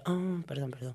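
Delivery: quiet, sheepish mock apology. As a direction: back down meekly and quietly. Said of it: "Digo yo".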